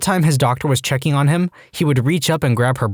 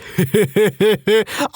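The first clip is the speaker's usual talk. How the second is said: high laugh; 9 semitones higher than usual. Retold or imitated. Laughing at patient hitting wall.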